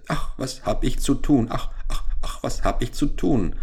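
schläfrig gegackert, höher als die Erzählstimme; gackernd im Schlaf. A narrator's reading. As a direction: wie ein Huhn im Traum vor sich hin gackern. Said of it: "gackerte es leise im Schlaf". (Henne gackert verschlafen im Traum)

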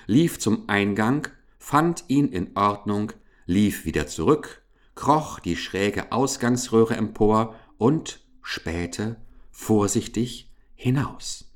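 usual speaking voice

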